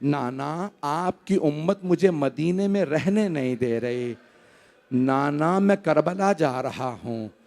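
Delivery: low, soft, drawn-out imitation of weeping; tearful lament. Hussain at his grandfather's tomb, voiced as tearful lament. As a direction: lament softly, stretching the words of address. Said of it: "बुलंद आवाज़ से रो के कहने लगे".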